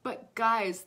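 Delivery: normal talking voice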